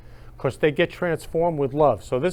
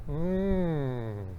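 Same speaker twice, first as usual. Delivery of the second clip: soft, drawn-out "mm"; long puckered hum; 6 dB quieter than usual. Retold or imitated. Host admiring the rental girl's pucker, long soft hum. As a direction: hum softly through puckered lips.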